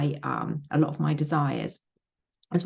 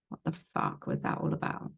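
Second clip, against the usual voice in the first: whispered, exasperated question to her subconscious; muttered whisper; 7 dB quieter than usual. Retold or imitated. Replaying how she cursed at her subconscious yesterday, in a whisper. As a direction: whisper the swear like a fed-up aside. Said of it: "this is how I said it yesterday".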